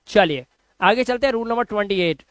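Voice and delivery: usual speaking voice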